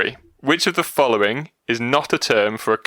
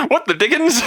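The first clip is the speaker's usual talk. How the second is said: high, loud laughing exclamation; 14 semitones higher, 4 dB louder than usual. Acted. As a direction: shriek it through giggles. Repeating joke exclamation, laughing.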